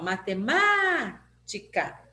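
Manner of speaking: "matemática" with a drawn-out MÁ; stress on MÁ. Shown as a demonstration. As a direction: slowly call "matemática", stretching the third syllable. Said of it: "O má que é o forte".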